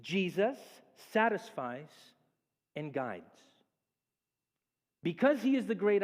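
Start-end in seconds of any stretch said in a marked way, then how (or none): 0.0-3.4 each word set apart with pauses; emphatic. Acted. Preacher drives home his main point, slow and deliberate. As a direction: space out Jesus, satisfies, guides. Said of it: "I want you to know this".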